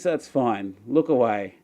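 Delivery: ordinary delivery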